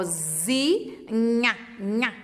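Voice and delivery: zi, then nha said twice with a pause; teacher stressing the last syllable; sounding out syllables. Shown as a demonstration. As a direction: repeat nha so the child hears the missing sound. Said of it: "Ó".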